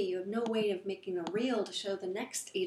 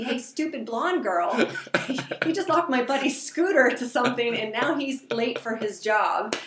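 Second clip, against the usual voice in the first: imagined local guy voice; 2 semitones higher, 8 dB louder than usual. Retold or imitated. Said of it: "was just trying to tell me".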